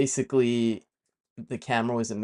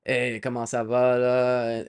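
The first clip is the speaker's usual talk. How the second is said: put-on a louder, rougher Quebec French accent; imitating a casual Montreal local; 5 dB louder, slower than usual. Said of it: "rough accent".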